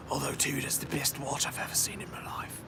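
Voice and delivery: hushed, very soft whisper; whispered aside. Pretend. Streamer secretly admitting love for Tudor to viewers, whispered aside. Confidential aside after refusing the topic.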